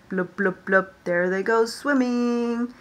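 playful bubbly fish blubs; mock fish blubbing; fish blub noises. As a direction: blow little bubble sounds for the kids. Imitating fish in story.

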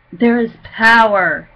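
POWER punched loud; emphatic declaration. Real, not acted. Testifier declaring the power of Jesus' name, emphatic. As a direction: hit POWER hard, then let it fall.

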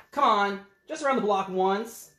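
pleading pigeon. Acted. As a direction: beg just one ride.

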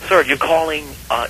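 normal talking voice

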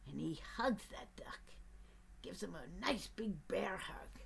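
soft cosy whisper. A narrator's reading. Narrator whispering the hug to the child. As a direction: whisper it like a bedtime secret.

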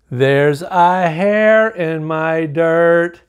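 slow, drawn-out title; slow storybook title; slow title read. Shown as a demonstration. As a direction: read the title slowly, savoring it. Title read slowly, dramatic.

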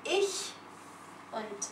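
"ich und ich wäre froh" said slowly, words spaced out. Shown as a demonstration. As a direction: stretch out "ich wäre froh" like a model for a learner. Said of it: "Plus lent, lentement". (dire "ich wäre froh" lentement, mot par mot)

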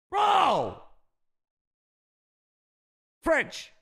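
high yell of disbelief; sudden high bro; excited shout. Real, not acted. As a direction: yell it at the screen.

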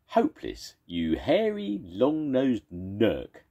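high, slow stretched-out jeer; shouted insult. Acted. Second monster shouts an insult at the first. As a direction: drag out the insult with relish. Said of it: "shouted the second monster".

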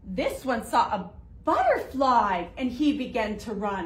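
usual speaking voice

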